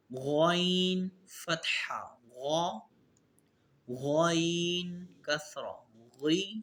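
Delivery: slow qaida recitation drill. Shown as a demonstration. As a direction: spell each sound out for pupils. Drilling heavy letters.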